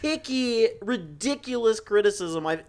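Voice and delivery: very high-pitched, hammed-up indignation; exasperated ranting. Co-host berating the nitpicky criticism on the podcast, exasperated ranting. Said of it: "my original point of yelling".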